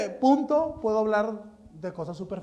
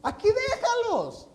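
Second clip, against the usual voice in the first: high, whiny pleading voice; mock pleading Jesus; slower than usual. Preacher parodies Jesus begging the Father, high and whiny. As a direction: beg like a melodramatic son, pitched up. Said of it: "No, no es cierto".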